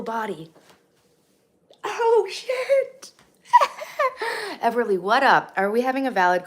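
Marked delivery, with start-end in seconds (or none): 1.8-4.6 high, squealing laugh through the words; helpless high-pitched laughter; laughing outburst. Real, not acted. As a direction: burst out laughing as you say it. Bursts out laughing mid-stream.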